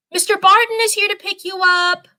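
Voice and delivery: high mother calling upstairs. A narrator's reading. Mom calls her daughter downstairs, calling out. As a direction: call it up the stairs. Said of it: "you hear your mom call".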